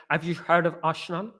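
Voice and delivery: soft mock Dutch accent, "Arshenal". Shown as a demonstration. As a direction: quietly put on a Dutch accent. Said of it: "That's a Dutch accent".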